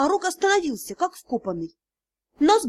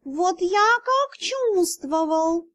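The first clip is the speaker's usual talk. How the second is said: high, very slow grim cat voice; cat spirit grimly to the others; gloomy cat; 5 semitones higher, much slower than usual. Acted. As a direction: say it darkly, like a cat. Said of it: "мрачно произнёс".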